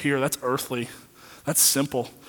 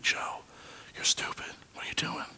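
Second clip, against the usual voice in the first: hushed unvoiced whisper of God's inner voice; God whispering a rebuke to Joe; 11 dB quieter than usual. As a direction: whisper it like a voice inside your head. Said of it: "he doesn't really whisper to me".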